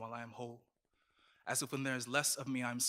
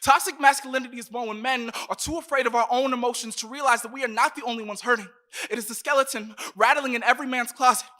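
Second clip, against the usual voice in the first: loud, higher, passionate slam delivery; impassioned; 5 semitones higher, 9 dB louder than usual. Acted. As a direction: build to a fierce climax. Climax of the poem.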